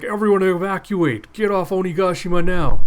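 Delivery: panicked yell. Acted. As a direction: shout the order like a fleeing grunt.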